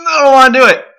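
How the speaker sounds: loud, high protest before the plunge; dreading shout. Real, not acted. Dreading the hot sauce.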